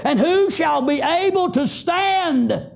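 high, shouted scripture, slowing at the end; full-force preaching. Acted. Preacher shouting Revelation to his flock, high and slowing. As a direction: cry the verse out over the congregation.

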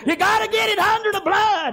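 high, loud preacher's cry; fervent shout. Retold or imitated. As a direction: hammer the warning home.

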